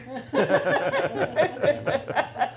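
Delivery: loud burst of real laughter; hearty laugh. Real, not acted. Laughing at the joke.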